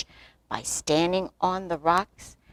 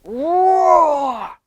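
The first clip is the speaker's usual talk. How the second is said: long, loud storybook roar; bear roar; 10 semitones higher, 6 dB louder than usual. A narrator's reading. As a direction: draw the roar out with a high swell. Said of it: "barked in his deep, gruff voice. He roared".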